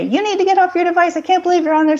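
high, fast scolding parent voice; nagging parent. Retold or imitated. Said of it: "poke at kids and say".

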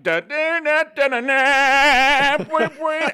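goofy vocal saxophone imitation; mock saxophone. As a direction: sing a clumsy sax line with your voice.

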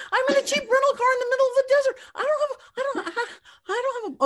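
stranded alone in the desert, hammed-up panic; mock panic. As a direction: whimper it out like a scared city girl.

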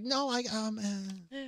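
hesitant mimicry fading away; trailing off. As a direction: let the imitation peter out into ums. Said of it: "You're trailing off".

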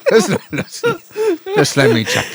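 laughing mock Chinese accent; laughing through a mock accent.

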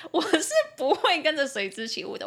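normal talking voice

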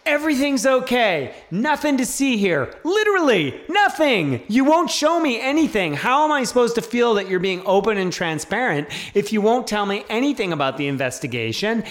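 mock the bureaucrats' nothing-to-see-here tone; mocking the agency. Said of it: "And it's just like".

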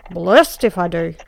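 a coarse cabman excusing himself; gruff apologetic cabby. Acted. Cabby excusing himself to the lady passenger, gruff and apologetic. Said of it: "gruffy, apologetic tone".

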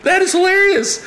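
very high, loud imitated exclamation; retold hilarity. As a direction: crow it like an astonished, amused friend. Retelling friend cracking up in disbelief.

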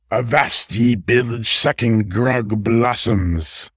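slow, growling pirate captain's bark. Acted. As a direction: play the swashbuckling pirate ambushing his friends. Pirate raccoon ambushes them.

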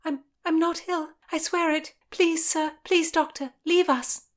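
high, stammering plea; frightened stammer. A narrator's reading. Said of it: "Constance stammered".

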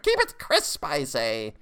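put-on high old witch bark; cranky crone ordering; high witch command. A narrator's reading. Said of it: "the crone declared".

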